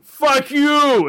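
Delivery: drawn-out, high mock-outraged yell; mock angry listener. Offended homeless listener replying to the host, mock angry yell. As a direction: yell it slowly as a hammed-up heckler.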